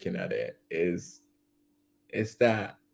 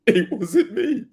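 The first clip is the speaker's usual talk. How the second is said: "[name]" lightly sung in a laughing [name] voice, louder; a hint of [name]'s "[name]", higher and laughing; 11 semitones higher, 6 dB louder than usual. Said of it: "It turned into [name]".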